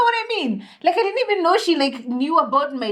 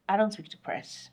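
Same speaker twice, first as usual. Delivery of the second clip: cold shut-down; 2 semitones lower, 10 dB quieter than usual. Retold or imitated. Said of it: "the voice changed. Everything changed. The tone changed".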